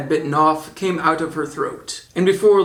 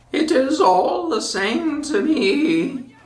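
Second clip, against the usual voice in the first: put-on high, slow crackly old woman laughing; sly old peddler woman, amused; old witch voice; 10 semitones higher, slower than usual. A narrator's reading. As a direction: cackle it out as the disguised queen. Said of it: "answered the woman".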